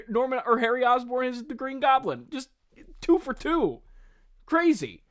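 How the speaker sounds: high, loud, over-the-top fake cheer; sarcastic mock glee. Mocking the villain's lucky plan with sarcastic mock cheer. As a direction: pretend to celebrate the absurd luck.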